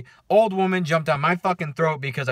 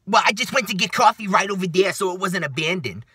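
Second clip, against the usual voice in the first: higher-pitched mock New York woman; New Yorker accent; 5 semitones higher, 4 dB louder than usual. Woman excusing her absence to staff, mock New York accent. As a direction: imitate a protesting New Yorker. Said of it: "like, New Yorker accent".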